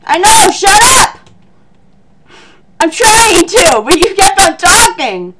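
high, loud comic exasperation; acted high-pitched irritation; exasperated shouting. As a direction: argue back as if interrupted mid-fight. Hero snaps at instructions mid-battle.

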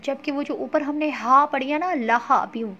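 normal talking voice

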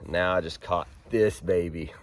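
usual speaking voice

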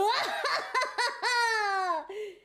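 high, loud hammy villain cackle; over-the-top evil laugh. Pretend. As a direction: cackle triumphantly.

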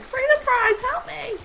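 very high, loud pretend cries for help; mock screaming. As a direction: squeal hammed-up panic at the top of your range. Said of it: "I'll be screaming like".

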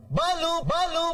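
man calling out for Balu, drawn-out call; slow drawn-out call. Acted. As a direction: stretch each Balu long and high.

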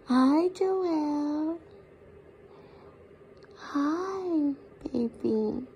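ordinary talk, nothing marked

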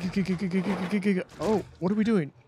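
high stammering panic; stuttering in terror while fleeing; yelling while running away. Real, not acted. Fleeing monster in game.

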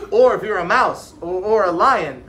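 put-on boy insisting in class; emphatic. A narrator's reading. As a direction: insist on every word. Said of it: "Ernie said emphatically".

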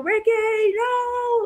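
very high shout of alarm; shouting at the screen. Retold or imitated. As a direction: yell a warning like he's about to get shot. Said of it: "I was like".

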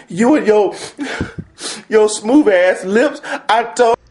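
loud, very high tearful whine; emotional meltdown. Acted. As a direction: wail your grievance at your teammates. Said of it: "I'm a little bit emotional".

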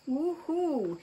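high happy woohoo; cheering. Real, not acted. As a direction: cheer it out like a win. Cheering at new package.

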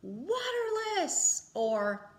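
waterless said high and slow, drawn out; sing-song high. Shown as a demonstration. Teacher showing the two answer choices, high. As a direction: lift the word waterless up high. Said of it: "answer with the word".

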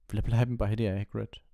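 put-on a young girl holding back tears; Hermione fighting tears. A narrator's reading. Hermione begs Hagrid, holding back tears. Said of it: "kämpfte mit den Tränen".

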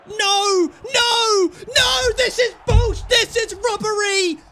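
high, loud cries of protest; real outraged outburst; outraged shouting. Real, not acted. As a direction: yell in disbelief at being robbed. Conceding a late goal.

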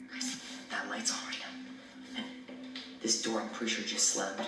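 soft, low whisper to the viewers; hushed alarm. Acted. Spooked, reporting to the viewers.

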